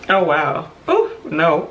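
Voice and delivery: teary, wavering little exclamations; caught by tears. Real, not acted. Host surprised by her own tears, teary and shaky. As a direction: overcome mid-thought, half laughing, half crying. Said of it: "the cry breaks".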